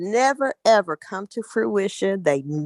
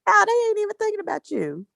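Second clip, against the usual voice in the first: higher and fast, rushing it out; excited high voice; 10 semitones higher, much faster than usual. Real, not acted. Laughing along with her friend, fast excited burst. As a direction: blurt it out, all lit up.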